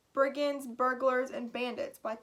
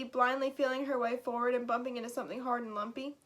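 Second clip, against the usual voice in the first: ordinary delivery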